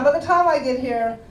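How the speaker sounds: normal talking voice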